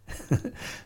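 short breathy laugh at oneself; self-amused laugh. Real, not acted. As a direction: let a small chuckle slip out. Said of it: "I'm laughing at myself".

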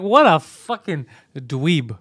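slow, loud, drawn-out jeer; drawn-out mockery. Friends reacting to the robbed man's story, drawn-out mockery. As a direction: stretch the insult out slowly and loudly. Retelling their mocking reply to friend.

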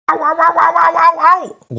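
high yelps of pain; smertensråb. A narrator's reading. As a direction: cry out as if your tongue is burnt. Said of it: "råber han, da han brænder sig". (høje smertensskrig)

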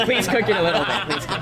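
half-laughing, playful begging; laughing plea. Real, not acted. Diner begging not to get it rare, laughing plea. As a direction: plead through a laugh.

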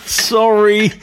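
high, slow, dazed voice of a returned spaceman; returned astronaut. Acted. Astronaut home from space, to his wife, high and slowed down. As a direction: play a spaceman who is high and slowed right down, lost back on Earth. Tim forgets gravity.

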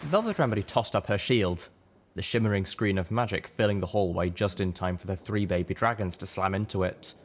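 ordinary delivery, nothing marked

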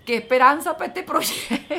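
laughing out loud mid-sentence, loud; half-crying, half-laughing at the absurdity; laughing through tears. Real, not acted. To Omar, mocking herself in the bathroom, laughing loudly.

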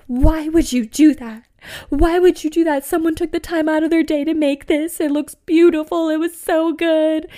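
loud, high-pitched mock wail of a furious friend; Margot, tearful outrage. Friend so mad about knocked-over rocks, mock-tearful outrage. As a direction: imitate a drunk friend wailing in protest. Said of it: "She gets so mad at me".

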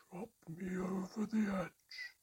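high, slow, breathless whisper; barely there, a whisper from far away; tiny whisper. A narrator's reading. The tiny mermaid whispering up from cupped hands, high and slow. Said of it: "the tiniest of whispers".

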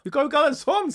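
high, loud laughing north Norwegian voice; chuckling north Norwegian official; laughing north Norwegian accent. Retold or imitated. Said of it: "og da lo han av meg".

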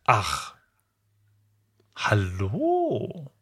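drawn-out weary "Ach, hallo" falling at the end; a wounded, sarcastic greeting with a sob in it; pained sigh greeting. Shown as a demonstration. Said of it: "Wie hättest du's ausgesprochen".

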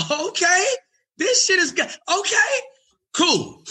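barely holding in joy; giddy high glee. Retold or imitated. His inner glee retold.

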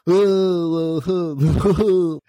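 mock stoner drone, drawn out; goofy stoner "uhh"; dopey pothead noise. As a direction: imitate a dim, zoned-out pothead moan. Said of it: "they always make the pothead like".

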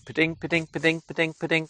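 high, steady repeated chirp; piping two-note call on repeat; great tit call imitation. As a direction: mimic the bird, evenly pitched high. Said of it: "So that's a great tit".

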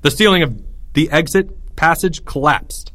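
ordinary delivery, nothing marked

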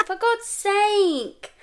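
slow, high, loud for God's sake; opening the wrong stock, a slow loud groan of despair; exasperated groan. Retold or imitated. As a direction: drag it out in disgust. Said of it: "and I go".

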